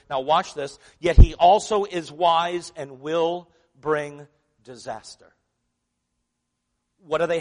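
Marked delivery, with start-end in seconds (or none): 1.0-5.3 whole line punched slow and loud, leaning on "disaster"; emphasized reading. Shown as a demonstration. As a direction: drive home "Yet he also is wise and will bring disaster" slowly and loudly, hitting "disaster". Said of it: "I'm gonna emphasize a different word".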